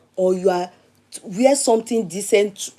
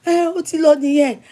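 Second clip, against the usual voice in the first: mock helpless reply; 6 semitones higher than usual. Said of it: "I said".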